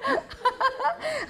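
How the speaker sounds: a long high laugh falling away; real delighted laughter; high laughing fit. Real, not acted. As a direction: crack up at your own joke. Laughs at own story.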